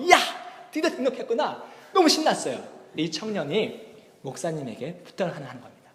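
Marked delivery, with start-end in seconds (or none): 0.0-1.6 gleeful cheer with a laugh; delighted. Retold or imitated. Pastor greeting the new member, delighted. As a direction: sound thrilled he finally signed up. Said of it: "목사님이 막 너무 좋은 거예요".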